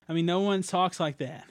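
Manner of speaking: ordinary talk, nothing marked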